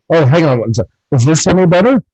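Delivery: usual speaking voice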